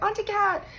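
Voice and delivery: put-on very high tearful little-kid voice; little crying girl. Four-year-old niece calling for her aunt, high tearful.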